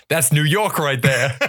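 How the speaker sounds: put-on proud New York accent call-out. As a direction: imitate a New York kid piping up. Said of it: "And then he goes again".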